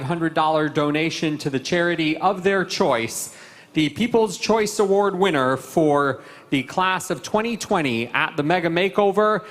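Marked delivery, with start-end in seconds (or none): 3.7-9.5 award announcer. Real, not acted. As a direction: build up to the winner's name.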